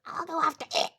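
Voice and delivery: high, loud put-on voice; cartoon character voice. She offers to go after it, high character voice. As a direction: pitch it up loudly for a toy character. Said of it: "And she goes like".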